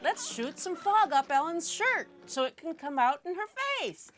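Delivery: egging the crew on, hammed up; mock gleeful director. Sam egging crew to fog Ellen, gleeful call. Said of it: "Sam would say".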